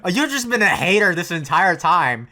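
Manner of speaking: loud, high-pitched rant at a character; indignant outburst. Real, not acted. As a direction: yell back in outrage. Viewer rants back at Asuka.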